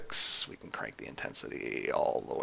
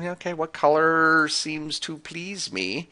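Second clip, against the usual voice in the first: higher, drawn-out pondering to oneself; lightly played user mulling over a choice, slowly; musing aloud; 8 semitones higher, 4 dB louder, slower than usual. Acting out a user choosing a colour.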